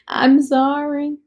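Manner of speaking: slow sorry, laughing through it; laughing apology. Real, not acted. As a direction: laugh it out slowly, half apologising. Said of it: "Am I problematic?".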